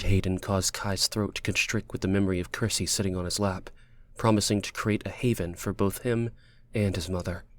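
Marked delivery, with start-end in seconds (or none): none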